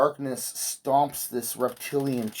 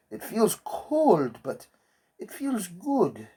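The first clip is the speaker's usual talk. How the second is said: slow, higher creepy whisper-like lines; eerie character; 6 semitones higher, slower than usual. Retold or imitated. Quoting Gustavo about the darkness, slow eerie voice. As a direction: linger over it like a haunted man.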